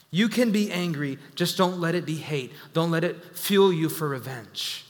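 ordinary talk, nothing marked

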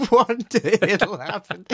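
high, loud, laughing through the words; helpless laughing retort. Real, not acted. Joking back amid his own laughter.

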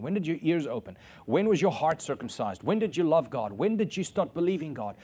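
usual speaking voice

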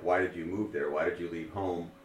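ordinary talk

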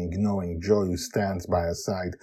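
usual speaking voice

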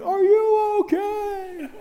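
high, long-drawn mock scream; alarmed wife. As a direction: imitate a panicked wife shrieking out the question. Wife runs to crashed husband.

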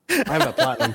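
high, laughing through the brag; laughing boast. Real, not acted. Teasing his co-host about his platinum trophy, laughing. Laughing at co-host's Fallout jab.

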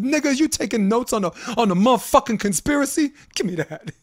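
high mock exasperated quote, laughing at the end; quoting Stringer Bell. Friend retells Stringer scolding a dealer, high and exasperated. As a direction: hams up the scolding. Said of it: "He said".

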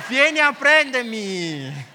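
loud, drawn-out dare; defiant call. Acted. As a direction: call it out slowly as a taunt. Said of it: "e avrei detto".

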